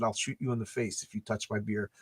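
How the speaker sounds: low, hushed retold threat; retold whispered threat. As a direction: drop low and soft, imitating a quiet warning. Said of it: "I just whispered".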